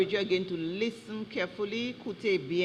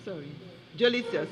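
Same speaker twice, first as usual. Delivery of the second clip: ordinary talk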